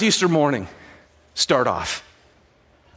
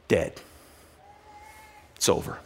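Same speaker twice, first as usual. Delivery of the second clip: usual speaking voice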